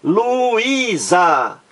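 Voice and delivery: Luísa drawn out in three syllables, high; syllable-by-syllable demo. Shown as a demonstration. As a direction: break Luísa slowly into Lu-í-sa. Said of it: "vamos aqui à separação silábica".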